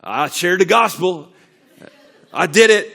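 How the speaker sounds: smug church-goer bragging; self-congratulating. Retold or imitated. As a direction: pat yourself on the back out loud. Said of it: "tapped ourselves on the back".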